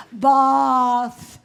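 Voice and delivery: mock British elocution, words stretched slowly; hammed-up British elocution pupil; posh British accent. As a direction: say it like a proper British lady, slow and pretentious. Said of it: "say words with just enough pretension".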